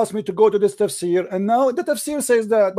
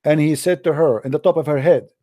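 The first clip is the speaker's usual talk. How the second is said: solemn story reading; 6 semitones lower than usual. Acted. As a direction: read it out deliberately to the audience. Reads a miracle story aloud.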